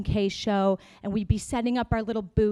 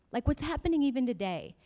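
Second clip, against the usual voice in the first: ordinary talk, nothing marked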